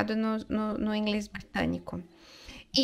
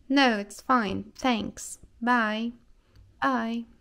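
read-aloud dialogue; slower than usual. Shown as a demonstration. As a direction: carefully read English dialogue, both roles. Reads English dialogue aloud, both roles.

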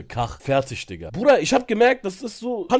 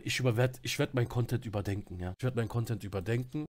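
much lower and much softer, mock-meek apology; fake remorseful role; 8 semitones lower, 10 dB quieter than usual. Streamer fakes meek remorse to Linda, soft and low. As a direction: play the humble, sorry penitent to fool her. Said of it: "hab ich natürlich eine Rolle mir angeeignet".

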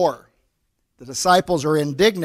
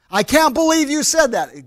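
quick indignant snap, higher and louder; sudden flare-up of indignation; 7 semitones higher, 5 dB louder than usual. Shown as a demonstration. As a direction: flare up from calm to anger in an instant. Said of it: "It's the flare-up".

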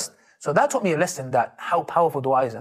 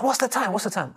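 urgent asking; 7 semitones higher than usual. Retold or imitated. Friend urgently asking the time.

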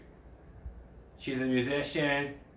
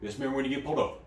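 ordinary talk

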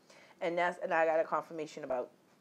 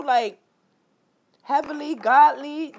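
ordinary delivery

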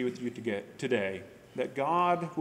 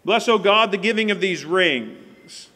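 loud, raised solemn prayer voice; praying aloud; 4 semitones higher, 10 dB louder than usual. Acted. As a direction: proclaim the prayer to the whole room. Ring blessing and prayer.

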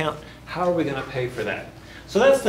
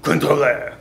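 Contrôleur said with a French accent, louder; a rough French accent on Contrôleur; French pronunciation; 6 dB louder than usual. Shown as a demonstration. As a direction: say Contrôleur the French way, with dropped letters. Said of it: "here's what it sounds like".

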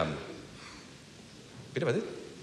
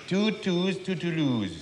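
lightly voiced Englishman, pitched up; English traveller; 6 semitones higher, much slower than usual. Englishman asking a railway official for a ticket, lightly caricatured. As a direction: play a joke's Englishman at the ticket window. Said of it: "Ein Engländer geht in Toulouse an den Bahnhof".

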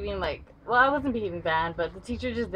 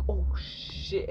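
whispered oh shit; 4 dB quieter than usual. Retold or imitated. Girl hit by paper ball.

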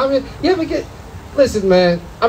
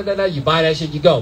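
ordinary talk

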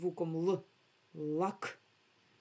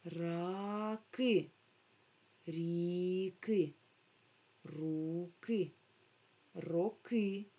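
slow, drawn-out syllable-by-syllable reading; sounding out syllables; much slower than usual. Shown as a demonstration. Teacher showing children how sounds join into words, drawn-out syllables. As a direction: stretch «Раки, ріки, руки, роки» syllable by syllable. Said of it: "поєднуємо в слово ці всі звуки".

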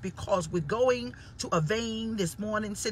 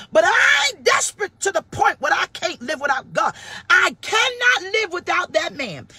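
loud and high, slowing on the last line; fervent preaching shout; passionate shout; 6 semitones higher, 7 dB louder than usual. Real, not acted. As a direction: cry out your desperation.

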